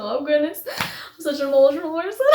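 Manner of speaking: high, choked-up voice breaking into a laugh; real emotion rising in pitch; getting emotional. Real, not acted. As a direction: well up, then laugh it off. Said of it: "I'm gonna get emotional".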